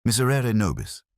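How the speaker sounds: usual speaking voice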